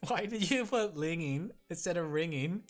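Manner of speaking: normal talking voice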